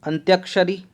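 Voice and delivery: ordinary talk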